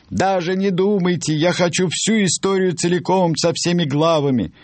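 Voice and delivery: very high-pitched, adamant character voice; insistent Reader. Acted. Reader refuses to let the author skip a chapter, high insistent voice. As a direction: push it up high and demand everything.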